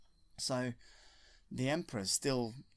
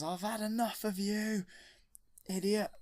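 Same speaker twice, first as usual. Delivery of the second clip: higher, louder furious outburst; angry Vader; 9 semitones higher, 4 dB louder than usual. Retold or imitated. Darth Vader turning on the Emperor, loud and furious. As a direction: snap it at him in a rage.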